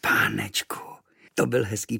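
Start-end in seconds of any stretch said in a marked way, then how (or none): 0.0-0.8 put-on boy marvelling at the little room, whispering; whispered wonder. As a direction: breathe it in awe.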